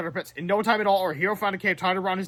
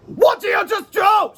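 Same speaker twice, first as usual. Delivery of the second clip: much higher, slow, loud panicked shriek; hysterical scream; 7 dB louder, slower than usual. Acted. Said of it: "screamed Mister Crump hysterically".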